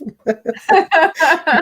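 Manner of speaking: embarrassed giggle, trailing off; nervous laugh. Real, not acted. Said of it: "That's a nervous laugh".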